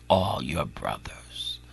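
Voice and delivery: trailing whisper; hushed whispered warning. Real, not acted. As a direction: fade into a whisper. Sermon fades into whisper.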